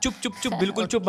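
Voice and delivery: high, hammed-up shush; mock outrage. Cutting off his friend in mock outrage. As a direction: shut him up in fake indignation. Friend blames him, he protests.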